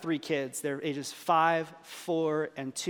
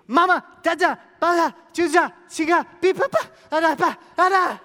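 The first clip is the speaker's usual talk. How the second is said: put-on high, loud babbling toddler tantrum; imitated shrieking baby talk; mock toddler scream; 9 semitones higher, 9 dB louder than usual. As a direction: scream baby gibberish like a frantic minion. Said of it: "he would scream".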